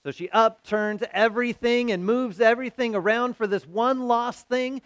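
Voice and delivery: usual speaking voice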